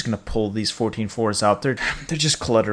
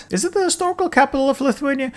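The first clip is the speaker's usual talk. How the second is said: mock clueless voice; 13 semitones higher, faster than usual. Mock question in another voice.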